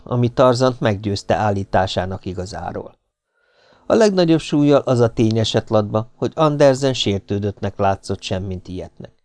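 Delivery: usual speaking voice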